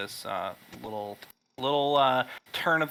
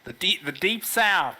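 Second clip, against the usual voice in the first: put-on southern accent; 6 semitones higher, 4 dB louder than usual. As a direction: play a folksy Southern country boy. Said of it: "speaking in a southern accent".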